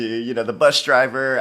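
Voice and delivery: ordinary talk, nothing marked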